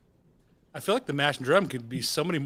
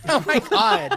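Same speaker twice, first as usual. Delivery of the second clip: sassy squeal; 12 semitones higher, 4 dB louder, slower than usual. Acted. Campy reaction.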